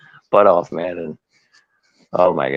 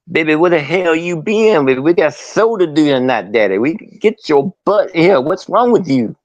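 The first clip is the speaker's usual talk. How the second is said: exasperated boss, pitched up and sing-song; Dusty impression; 5 semitones higher than usual. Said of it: "impersonations of guys besides Dusty".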